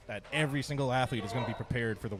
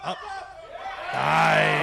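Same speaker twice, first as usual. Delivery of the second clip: loud, higher shout of approval; excited cheer; 6 semitones higher, 9 dB louder than usual. Real, not acted. Commentator to viewers as the lift succeeds, excited cheer. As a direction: cheer the lift at full volume.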